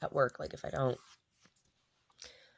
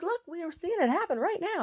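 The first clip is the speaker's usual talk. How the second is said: much higher, eager; excited remark. Retold or imitated. Telling the dog's dad about the mirror, high excited voice. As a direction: point it out with glee.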